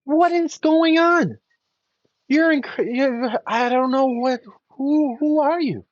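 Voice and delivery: a stunned king who can't believe his eyes; the emperor gasping out his questions to the giant fish; astonished king. Acted. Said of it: "he's like".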